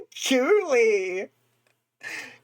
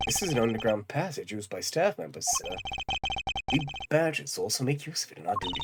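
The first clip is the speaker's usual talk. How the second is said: lightly voiced staff badger; 8 semitones lower, 6 dB quieter, faster than usual.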